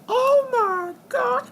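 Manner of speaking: squealing with joy, drawn out; excited squeal. Real, not acted. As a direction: shriek it out in delight. Huge sum of eco reached.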